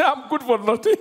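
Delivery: hammed-up tearful self-pity ending in a high wail; mock crying. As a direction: wail it like a crybaby. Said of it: "you start crying".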